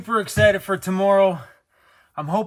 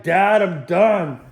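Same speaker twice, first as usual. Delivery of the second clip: slow, louder whiny complaint as the dog; dog's voice; 7 dB louder, much slower than usual. Retold or imitated. Dad voicing his dog who's fed up, slow whine. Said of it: "He's like".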